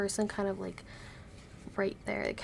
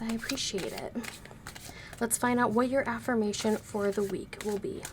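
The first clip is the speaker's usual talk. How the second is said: ordinary talk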